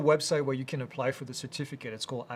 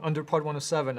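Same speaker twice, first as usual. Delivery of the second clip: ordinary talk, nothing marked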